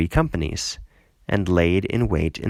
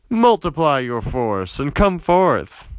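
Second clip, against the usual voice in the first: higher and slow, taunting dare; mocking challenge; 6 semitones higher, slower than usual. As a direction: sneer the dare out slowly, pitch climbing. Gaal mocks challenge to Abimelech.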